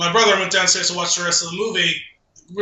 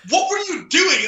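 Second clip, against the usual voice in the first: incredulous; 11 semitones higher than usual. Retold or imitated. Incredulous reply to sister.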